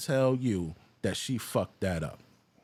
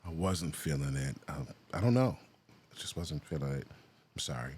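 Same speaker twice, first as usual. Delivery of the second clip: a light imitation of her mumbled apology; imitating her; 7 semitones lower than usual. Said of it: "The way she said".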